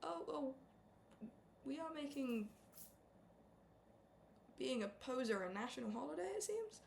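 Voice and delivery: lightly voiced mock outrage; high whiny sarcastic jab. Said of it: "It's like".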